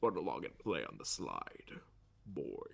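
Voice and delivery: low, breathy menacing voice; low villain voice. Acted. Villain line read aloud.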